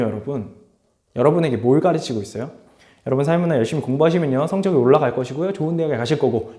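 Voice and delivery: ordinary delivery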